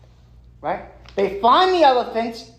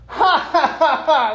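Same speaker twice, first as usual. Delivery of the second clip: hammed-up elephant belly laugh, higher pitched; big belly laugh; 4 semitones higher, 3 dB louder than usual. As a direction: act out a booming mock "ha ha ha". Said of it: "big belly laugh from an elephant".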